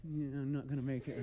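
low, soft, half-laughed mock worry; retold nervous aside under the breath; hiding fear. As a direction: mutter it while pretending you're fine. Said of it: "I'm getting a little scared, and I'm trying to hide it".

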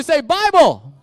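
loud, high shout to be echoed; called-out response. Acted. Pastor shouting the slogan for students to repeat, loud. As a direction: punch RUN and belt the phrase. Leader calls congregation response.

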